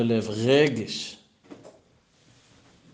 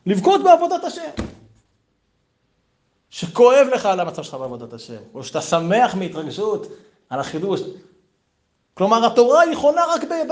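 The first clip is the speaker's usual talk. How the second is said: higher, louder preacher's cry; fervent preaching; 7 semitones higher, 5 dB louder than usual. Acted. Rabbi urging students to feel, preaching at full force. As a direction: rouse the room with fervor.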